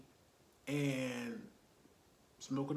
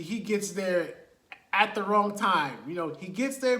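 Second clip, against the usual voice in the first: ordinary talk, nothing marked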